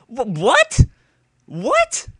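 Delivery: high, loud disbelieving what; can't believe what he heard; shrill real disbelief; incredulous what. Real, not acted. Incredulous at dumb excuse.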